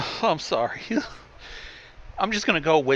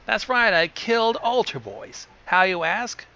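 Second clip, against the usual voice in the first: lightly put-on raspy voice, pitched up; a hint of rasp on a higher-pitched brag; thick raspy voice; 6 semitones higher than usual. A narrator's reading. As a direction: boast with a touch of thick rasp. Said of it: "said in a thick, raspy voice".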